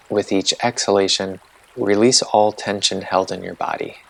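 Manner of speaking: calm, unhurried meditation guide voice; guided meditation. Acted. Host guiding listeners through a body-sensation meditation, calm and unhurried.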